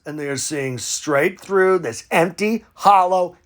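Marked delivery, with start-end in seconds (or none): none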